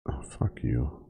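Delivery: soft whispered fuck you; quiet unvoiced curse. Real, not acted. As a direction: hiss it under your breath.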